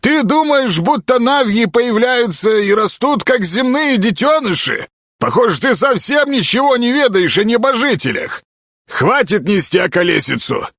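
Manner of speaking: high-pitched, decisive rebuke; firm, high character voice; resolute. A narrator's reading. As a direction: put him firmly in his place. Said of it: "решительно заявил высокий".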